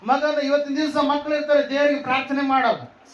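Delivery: high proclamation in punched-out phrases; fervent preaching. Acted. Preacher praising a son who prays, fervent high preaching. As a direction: proclaim each phrase high, then pause.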